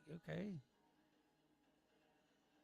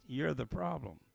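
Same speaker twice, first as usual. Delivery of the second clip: normal talking voice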